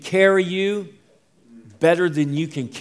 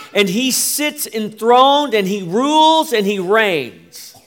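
rising preaching cadence; 6 semitones higher, 8 dB louder than usual. Acted. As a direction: build it up like a sermon climax. Preaching build-up.

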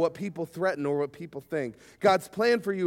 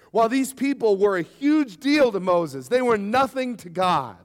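normal talking voice

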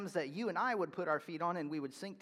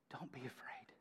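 much softer and lower, an unvoiced whisper; tender whisper; 6 semitones lower, 16 dB quieter than usual. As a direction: breathe it gently to frightened friends. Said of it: "he doesn't chastise us".

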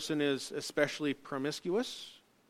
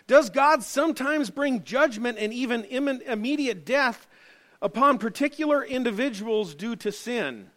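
higher, louder pulpit voice; raised preaching voice; preaching at full force; 8 semitones higher, 10 dB louder than usual. Acted.

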